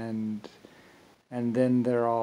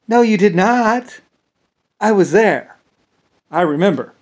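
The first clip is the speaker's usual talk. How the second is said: indignant retort; 7 semitones higher, 10 dB louder than usual. Retold or imitated. Answering people who claim they backed him, indignant. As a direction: shut them down firmly.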